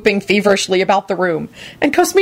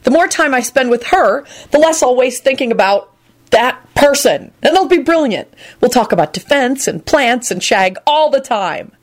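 resolute; 7 semitones higher than usual. A narrator's reading. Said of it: "he decided resolutely".

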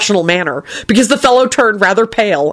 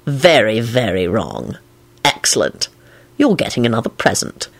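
low delighted Lupin voice; 7 semitones lower, 3 dB quieter than usual. A narrator's reading.

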